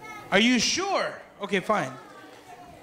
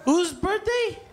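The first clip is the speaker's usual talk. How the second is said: raised crowd question; calling out; 10 semitones higher, slower than usual. Real, not acted. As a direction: call it out over the party noise.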